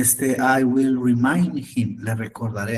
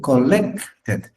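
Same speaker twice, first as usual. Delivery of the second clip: "collected" as col-LEC-ted, middle drawn out; second-syllable stress; 3 dB louder than usual. Shown as a demonstration. Teacher corrects student's collected, stressing the second syllable. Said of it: "sin acento en la E".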